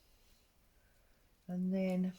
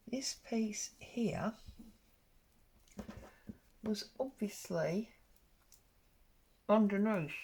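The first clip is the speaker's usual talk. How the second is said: pin in mouth; 2 semitones higher than usual. Real, not acted. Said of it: "I had the pin in my mouth".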